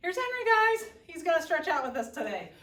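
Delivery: high, bright puppy intro; excited. Real, not acted. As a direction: show off the dog.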